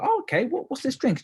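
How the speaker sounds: sudden high curious voice, pitch jumping up; surprised high question. Retold or imitated. Asking the waitress about the drink, surprised and high. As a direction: act amazed at the free drink.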